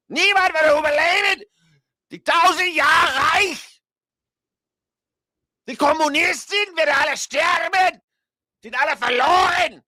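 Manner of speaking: very high, shouted mock German oratory; German dictator rant. Host likens the speech to Hitler's, shouting mock German rally rant. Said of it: "in a German accent".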